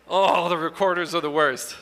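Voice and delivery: ordinary talk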